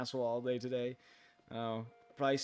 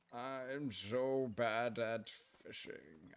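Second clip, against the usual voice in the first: slow, drawn-out, quieter whine; mock lament; 5 dB quieter, much slower than usual. Streamer moaning to chat about fishing, slow mock whine. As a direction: sulk it out slowly, half joking.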